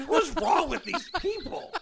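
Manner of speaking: high, laughing disbelief; cracking up in disbelief. Real, not acted. Retelling mom's reaction, laughing.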